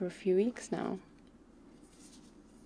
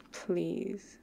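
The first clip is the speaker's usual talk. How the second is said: normal talking voice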